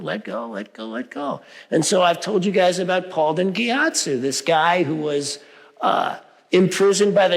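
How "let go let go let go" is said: hushed mantra. Retold or imitated. Soft mantra of letting go.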